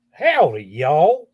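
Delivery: imitated drawling Southern howdy accent; country accent. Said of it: "he's got a little bit of an accent like that".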